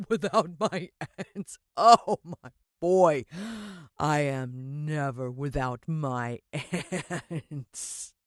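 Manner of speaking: very slow, sinister villain voice with a chuckle; gloating villain. Acted. Mister Motcher gloats to the boy, very slow and sinister. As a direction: drag it out, relishing the threat.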